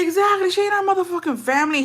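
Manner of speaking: high, indignant agreement; worked-up retold rant; heated. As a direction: fire back in shared outrage, pitch soaring. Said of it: "He was heated too".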